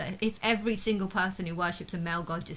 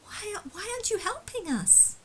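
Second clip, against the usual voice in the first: high, thin mocking imitation of demanding people; mocking whiny voice; 11 semitones higher, 7 dB quieter than usual. Mimicking people who beg him for help, high mocking whine. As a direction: mimic them whining for help.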